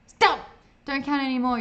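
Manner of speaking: sharp high shout to stop. Acted. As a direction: yell it out, loud and high. Player shouting at clerk.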